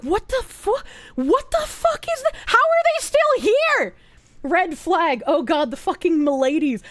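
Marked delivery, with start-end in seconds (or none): 0.0-3.9 high, squeaky disbelief; shocked outburst. Retold or imitated. Streamer retelling her reaction to the cult, high squeaky shock. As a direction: shriek it up in disbelief. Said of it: "And I was like".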